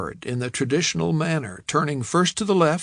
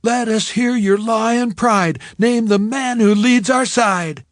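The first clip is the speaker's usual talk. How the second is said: high, loud chanted cheer; cheerleader yell; 9 semitones higher, 7 dB louder than usual. A narrator's reading. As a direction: yell it across the field. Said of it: "The cheerleaders would yell something like".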